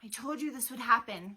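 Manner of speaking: a touch of storybook despair; wailing. A narrator's reading. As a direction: wail it as a complaint. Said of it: "Perdita wailed".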